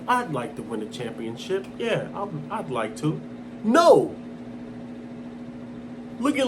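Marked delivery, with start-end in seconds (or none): 0.1-3.2 quiet, uncommitted mock quote; lukewarm. Imitating a champion with no hunger, lukewarm. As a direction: toss it off softly, without drive. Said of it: "sound like".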